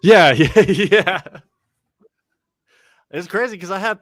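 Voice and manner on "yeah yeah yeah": laughing yeah through a loud laugh; cracking up, loud and high. Real, not acted. Laughing hard at his friend's story, loud laughing yeah.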